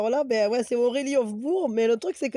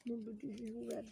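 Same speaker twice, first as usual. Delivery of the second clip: soft loving coo; tender cooing; 16 dB quieter than usual. Real, not acted. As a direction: coo it gently to a little one. Mother cooing to child.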